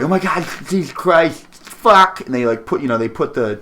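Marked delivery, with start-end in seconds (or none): 0.0-2.2 high, loud excited nerd squeal. Retold or imitated. Nerds flipping out over Megan Fox.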